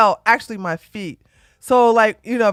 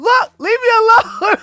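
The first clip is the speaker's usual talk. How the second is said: yelled through a laugh; high laughing shout; 13 semitones higher, 7 dB louder than usual. Retold or imitated. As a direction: shoo them off, laughing and yelling. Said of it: "laughing and yelling at them".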